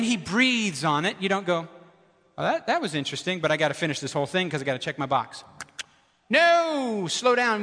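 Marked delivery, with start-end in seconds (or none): 2.4-5.4 hurried dismissive reader. Pretend. 6.3-7.6 no cried out much higher, slow down urged; sharp no. Real, not acted. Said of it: "emphatic correction".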